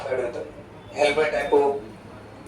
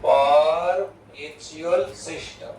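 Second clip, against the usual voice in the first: slow dictation; much slower than usual. Shown as a demonstration. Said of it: "મેં બધું લખી નાખ્યું".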